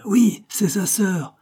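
high, drawn-out cry of agreement; crowd's exclamation. A narrator's reading. Crowd confirming the claim to the policeman, exclaiming. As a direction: stretch it out like a chanting onlooker. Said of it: "exclama tout d'une voix la foule".

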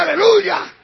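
high, booming hallelujah; rousing shout. Real, not acted. Preacher urging the church to respond, shouted. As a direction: belt it out as a call to praise.

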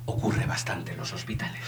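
conspiratorial whisper, dropping right down; hushed gossip aside. A narrator's reading. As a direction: let the gossip slip out as a secret. Aside about hospital errors.

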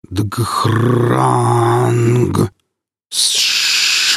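guttural growl, then breathy hiss; monster growl and hiss. Acted. Monster sounds in the dark.

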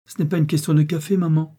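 firm, high-pitched retort. A narrator's reading. Daughter overruling her mother about the coffee, firm. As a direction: stand your ground, insistent. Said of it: "répliqua fermement Geneviève".

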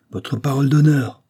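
a derisive retort before laughing; mocking cry. A narrator's reading. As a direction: throw his words back with a jeer. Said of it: "s'écria l'Amazone en éclatant de rire".

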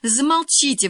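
high, loud exasperated burst; blurted out. A narrator's reading. Girl silencing the others over her homework, blurting. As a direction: cut them off with an outburst. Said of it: "выпалила Лиза".

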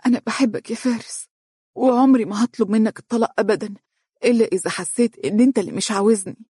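a touch of a tremble in an earnest promise; trembling with emotion. A narrator's reading. Wife vowing patience to her beloved, moved and trembling. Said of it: "وقالت بصوت مرتجف من فرط التأثر".

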